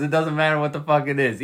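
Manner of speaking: ordinary talk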